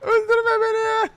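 put-on high, slow pleading child's voice; kid voice. Son begs his dad not to kill him, high child voice. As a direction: beg dad like a scared little kid. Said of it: "diye bağırışlar geliyor".

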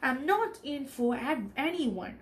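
usual speaking voice

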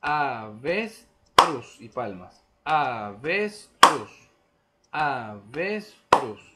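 avestruz beaten out loud and slow, syllable by syllable; syllable rhythm. Shown as a demonstration. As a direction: pound out avestruz like a chest drum. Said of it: "Entonces hacemos".

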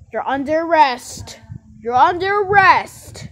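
loud, commanding play-acted officer; police arrest shout. Officer arresting the kidnapper, acted loud command.

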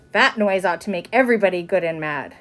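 usual speaking voice

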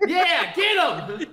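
high, slow, laughing cheer; laughing egging on. Real, not acted. Friend laughing, urging the host to tease David. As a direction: egg him on through laughter.

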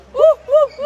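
high, loud repeated whoops; celebratory whooping. Real, not acted. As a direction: whoop in short joyful bursts. Celebrating the win. (gritos de júbilo, uh uh)

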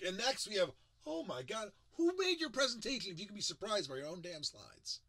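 hammed-up shock at the next slide; mock flustered surprise. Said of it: "they're like".

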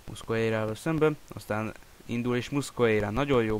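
ordinary talk, nothing marked

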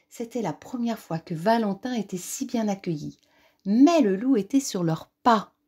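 ordinary delivery

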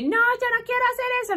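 put-on high, fast whiny teenager; whiny teen refusal. Teen refusing the bar mitzvah, high whiny voice. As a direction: whine it like a stubborn teen. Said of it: "Le chinite está como".

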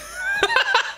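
very high, helpless laugh. Real, not acted. Streamer laughing at a botched accent, high laugh. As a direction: crack up at your own mistake.

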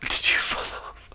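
breathy whispered question. Real, not acted. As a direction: whisper it under your breath. Hushed question mid-game.

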